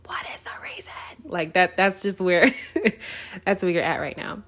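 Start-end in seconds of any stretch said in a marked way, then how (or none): 0.1-0.9 whispered echo. Retold or imitated. Repeating the [name] line to herself, whispered. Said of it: "[name] clip when she's like".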